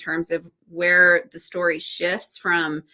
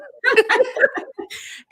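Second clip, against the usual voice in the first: bright high burst of laughter; 3 dB louder than usual. Real, not acted. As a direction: laugh out loud at the meme. Laughs at meme.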